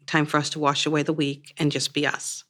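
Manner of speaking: ordinary delivery, nothing marked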